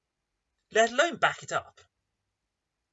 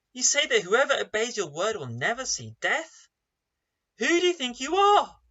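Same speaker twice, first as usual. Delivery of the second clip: louder, higher shocked outburst; shocked crowd; 4 semitones higher, 5 dB louder than usual. Acted. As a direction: voice the crowd's disbelief. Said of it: "Can you hear the shock in their voice".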